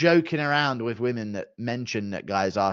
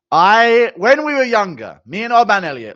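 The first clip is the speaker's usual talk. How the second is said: excited; 8 semitones higher, 9 dB louder than usual. Real, not acted. Hyped story start.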